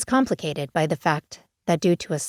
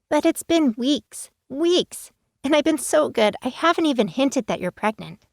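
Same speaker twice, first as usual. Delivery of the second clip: put-on impatient teen; 9 semitones higher than usual. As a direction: drag out weeks like a pleading kid.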